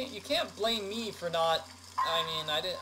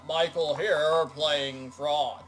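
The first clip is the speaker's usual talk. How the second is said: loud, slowing frog character voice; hammed-up frog host, words drawn out; Frogman; 2 semitones lower, 7 dB louder, slower than usual. Shown as a demonstration. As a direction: greet everyone as a croaking frog. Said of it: "Does that sound like a frog?".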